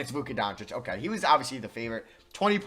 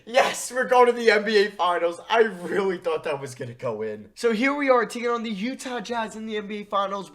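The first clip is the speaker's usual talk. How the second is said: higher and louder, jubilant cheering; triumphant shout; 8 semitones higher, 8 dB louder than usual. Real, not acted. Gamer after the final buzzer, jubilant loud cheering. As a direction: celebrate winning the series at full voice. Wins series on final shot.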